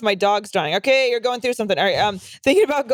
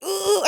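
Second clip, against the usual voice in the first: choked ugh; 11 semitones higher than usual. Retold or imitated. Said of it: "I'm like".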